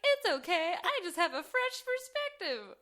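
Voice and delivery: high, sing-song mock heroine; self-satisfied heroine. Heroine reassuring her boss, mock-sweet and high.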